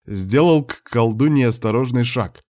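ordinary delivery, nothing marked

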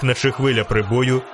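plain reading voice

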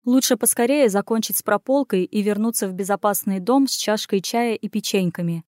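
ordinary delivery

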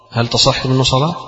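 usual speaking voice

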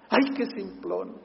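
laughing at his own plain answer; amused laugh. Real, not acted. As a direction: chuckle through the words. Pastor laughs at his simple advice.

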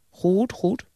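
high-pitched, theatrical exclamation; called out. A narrator's reading. Old man answering Jonathan's list, high exclaimed approval. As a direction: cut in with a high cry. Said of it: "riep de oude man".

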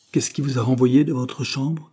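ordinary delivery

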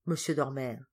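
usual speaking voice

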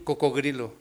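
"Cocodrilo" with g replacing d, as coco-GRI-lo; pupil's mispronunciation. Acted. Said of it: "¿Qué dice aquí?". ("Cocodrilo" mal dicho, con g en vez de d)

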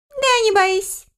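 very high, piping little voice; chuckly creature reassuring. A narrator's reading. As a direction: reassure in a tiny squeaky voice. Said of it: "успокоил смешливый обитатель дупла".

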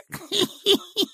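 very high relishing titter; lustful old man giggle. A narrator's reading. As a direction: giggle with nasty delight. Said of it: "Старичок сладострастно засмеялся". (сладострастный смешок)

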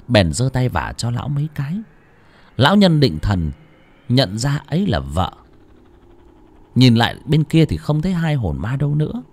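ordinary delivery, nothing marked

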